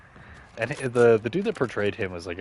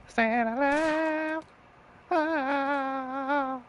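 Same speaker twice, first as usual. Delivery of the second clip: silly high voice; much slower than usual. Pretend.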